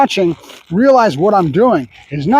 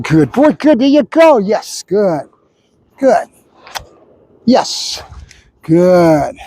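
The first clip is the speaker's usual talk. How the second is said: excited dog praise; much slower than usual. Real, not acted. Praising dog mid-training.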